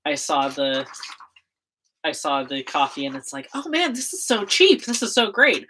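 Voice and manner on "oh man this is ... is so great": excited. Retold or imitated. Acted excited inner thought.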